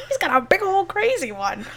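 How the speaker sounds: high, laughing retelling; laughing quote. Retold or imitated. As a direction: giggle through the quote.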